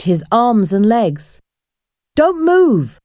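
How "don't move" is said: holding him up, shouting at him; shouted. A narrator's reading. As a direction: snap out the order while struggling. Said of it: "Lucy shouted".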